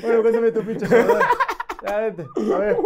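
host laughingly pressing guest to perform; laughing mock demand. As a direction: tease the guest to start his show, laughing. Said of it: "mock demanding, laughing".

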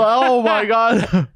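high, loud, drawn-out exclamation; hammed-up appalled cry; mock horror. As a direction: stretch it out in exaggerated dismay.

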